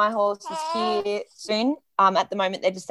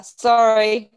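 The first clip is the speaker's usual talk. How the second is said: ordinary talk, nothing marked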